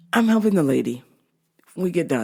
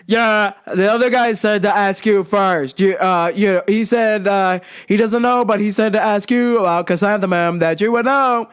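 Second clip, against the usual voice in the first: yelling deaf customer. Retold or imitated. Deaf customer asks store worker about chrysanthemums, imitated yelling. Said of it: "he's yelling back at me".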